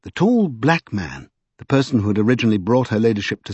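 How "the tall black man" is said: ordinary delivery, nothing marked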